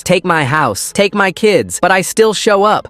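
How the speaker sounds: high-pitched aggrieved outburst; ranting recorded driver. A narrator's reading. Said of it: "auditorium fills with Mister Stone's voice".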